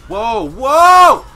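loud, high "whoa"; startled yell. Real, not acted. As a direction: shout it, building on the second. Startled during game.

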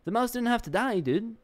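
high, indignant protest at the game; high-pitched whiny outrage at the game; exasperated outburst. Real, not acted. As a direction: complain loudly that the mouse died. Scolding the game, voice jumps.

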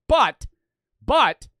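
loud, high single-word bursts; emphatic objection. Real, not acted. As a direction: punch each word out loudly. Interrupting his own concession to object.

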